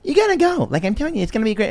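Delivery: high, pushy mom voice; mom impression. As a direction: urge them on like a mother. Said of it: "I'll do my mom being like".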